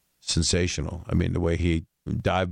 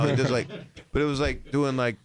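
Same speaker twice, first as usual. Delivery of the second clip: usual speaking voice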